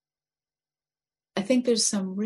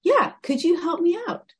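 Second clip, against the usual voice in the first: higher, louder eager plea; frog voice; 11 semitones higher, 7 dB louder than usual. Acted. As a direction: frog begging for help. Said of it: "And the frog says".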